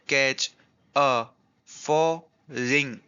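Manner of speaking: separate, evenly spaced beats on "कैच, अ, फॉर, ring"; syllable by syllable. Shown as a demonstration. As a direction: count out "कैच, अ, फॉर, ring" with a gap between each. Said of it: "syllables से count करते हैं".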